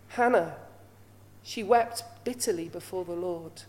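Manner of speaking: usual speaking voice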